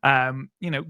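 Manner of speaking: ordinary talk, nothing marked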